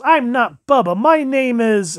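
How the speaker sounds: high, loud imitation of a protesting child; indignant kid. Dad imitating his kid rejecting a cute nickname, indignant. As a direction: protest like a kid, stretching the words. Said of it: "this reminds me of my kid".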